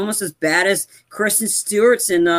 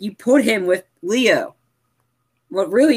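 ordinary delivery

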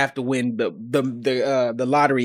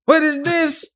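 mock baby shock; 3 semitones higher than usual. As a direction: act out the shocked baby's look. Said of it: "Be just like".

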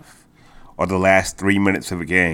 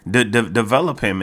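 normal talking voice

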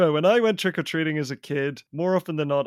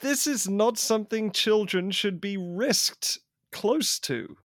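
mock outrage; 7 semitones higher than usual. Mock outrage at classroom explosion.

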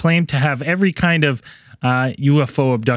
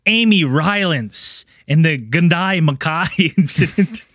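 put-on Australian accent; 6 semitones higher, slower than usual. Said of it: "I tried to say that in my Australian accent".

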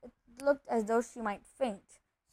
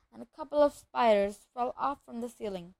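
usual speaking voice

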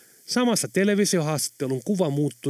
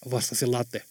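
ordinary delivery

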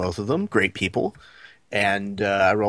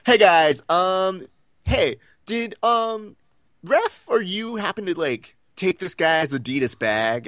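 higher, hesitant voice of a friend on the phone; worried caller; 8 semitones higher, slower than usual. Retold or imitated. Friend calling to ask about a missing bag, higher and hesitant. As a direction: raise the voice, halting and unsure.

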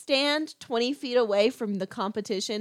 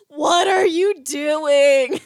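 drawn-out, high, loud what are you doing; mock exasperated cry; 7 semitones higher, 6 dB louder, much slower than usual. Friends yelling at the movie screen, slow high loud exasperation. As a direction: howl it slow at the screen in disbelief. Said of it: "we're all like".

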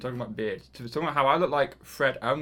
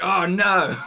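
mock horrified friend; 6 semitones higher, 8 dB louder than usual. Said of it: "You'll be like".

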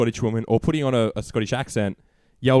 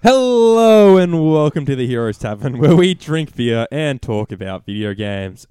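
loud, slow announcer welcome; booming podcast host opening; show host intro; 7 dB louder, slower than usual. Acted. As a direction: open the show like a presenter, drawn out.

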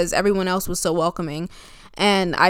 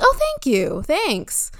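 surprised thanks; 7 semitones higher, much slower than usual. Retold or imitated. Surprised reply to compliment.

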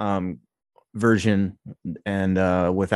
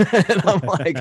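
laughing; 7 semitones higher, 3 dB louder, slower than usual. Real, not acted. Laughing while telling it.

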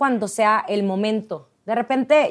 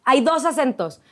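ordinary talk

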